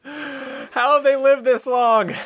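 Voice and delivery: high, loud, slow put-on voice of disbelief; squealed scorn at a hopeless team; mock incredulous voice. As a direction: stretch it out like an appalled spectator. Mocking the losing team.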